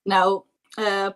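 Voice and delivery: ordinary delivery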